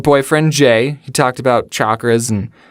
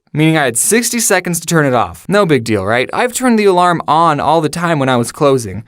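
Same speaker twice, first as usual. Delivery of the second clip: usual speaking voice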